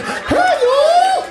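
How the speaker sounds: put-on very high southern-accented holler; comic falsetto call, southern accent; mock southern accent. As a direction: squeal it with a southern twang. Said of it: "It talks in a southern accent".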